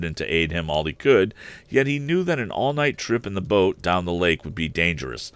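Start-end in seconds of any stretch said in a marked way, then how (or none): none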